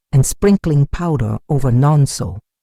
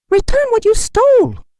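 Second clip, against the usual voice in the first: much higher, loud accusing yell; angry shout; 5 dB louder than usual. A narrator's reading. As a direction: hurl the word at a thief. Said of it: "they shouted".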